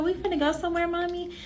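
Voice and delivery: a hint of a curious young daughter; child's question. Voicing her little daughter.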